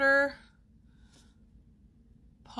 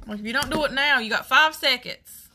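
louder, higher, punchy warning; mock ultimatum; 4 semitones higher, 8 dB louder than usual. Real, not acted. Giving someone a playful deadline over schoolwork, mock ultimatum. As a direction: lay down a loud five-second deadline.

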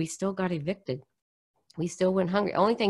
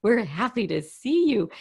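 chirpy welcome; 6 semitones higher, 5 dB louder than usual. Retold or imitated. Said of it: "she's like".

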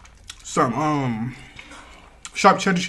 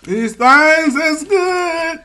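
excited; 13 semitones higher, 8 dB louder, much slower than usual. Real, not acted. Savoring food, raving.

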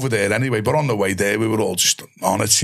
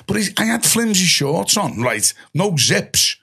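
ordinary talk

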